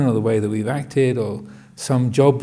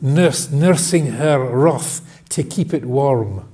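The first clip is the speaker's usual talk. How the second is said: slow, hammed-up Scottish accent; drawn-out mock Scottish poetry reading; bad Scottish accent; slower than usual. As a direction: recite it slowly like an amateur Scot. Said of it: "Forgive my bad Scottish accent".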